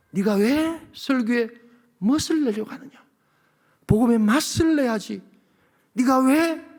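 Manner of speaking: a hint of God questioning him; voice from heaven. God rebuking the pastor's pacing, lightly voiced divine rebuke. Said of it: "그 하늘 음성처럼 좀 들리더라고요".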